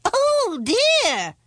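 high, loud wail of dismay; dotty wizard's alarm. A narrator's reading. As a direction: cry out as a flustered wizard.

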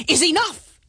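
high, loud royal outburst; exasperated, emphatic king; furious king. A narrator's reading. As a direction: lay down the law in fury. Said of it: "said the king in a fury".

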